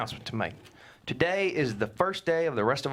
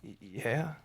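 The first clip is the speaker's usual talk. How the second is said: barely there, much softer yeah; sheepish admission; 3 semitones lower, 8 dB quieter than usual. Acted. Husband owning up to his wife in a tiny voice. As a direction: mumble it, caught out. Husband admits losing savings.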